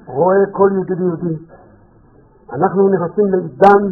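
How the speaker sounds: ordinary talk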